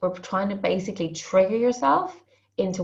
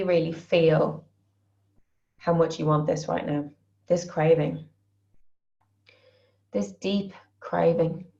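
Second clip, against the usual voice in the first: guided tapping phrases. Acted. Coach voices the group's craving while they tap, soothing and slow. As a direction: repeat each craving phrase calmly, one at a time.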